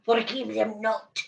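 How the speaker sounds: title read in a playful Russian accent; mock Russian accent. Shown as a demonstration. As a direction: say it like a hammed-up Russian. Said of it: "in the Russian accent".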